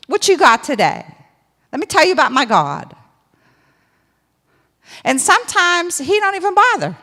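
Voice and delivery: high, loud preaching build; full-force preaching. Real, not acted. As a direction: preach it out over the congregation.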